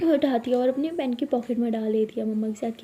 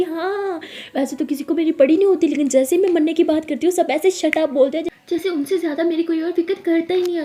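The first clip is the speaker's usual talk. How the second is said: overacted self-pity; 5 semitones higher, 5 dB louder than usual. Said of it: "ये over acting".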